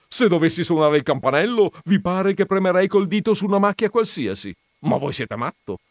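high, loud, quick indignant lecture; indignant Veneranda. Acted. Veneranda lecturing the confused gentleman, high and loud. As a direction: scold him as if he were mad.

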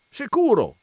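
high, loud, eager yes; eager coachman reply. A narrator's reading. Coachman answering don Michele's story, eagerly. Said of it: "rispose il cocchiere".

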